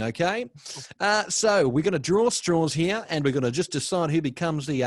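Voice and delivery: loud, upbeat radio host; bright DJ welcome back; breakfast radio voice. Pretend. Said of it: "Breakfast Radio voice up right now".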